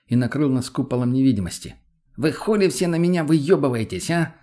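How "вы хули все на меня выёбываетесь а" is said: angry, higher-pitched challenge; sudden outburst. A narrator's reading. Addict jumps up to challenge the group, angry outburst. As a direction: flare up at everyone, pitch raised. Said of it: "Вдруг подорвался с места он".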